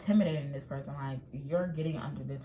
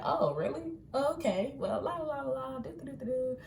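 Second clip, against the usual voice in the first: airy oblivious; 10 semitones higher than usual. Pretend. You, oblivious and carefree.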